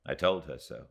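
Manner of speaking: plain reading voice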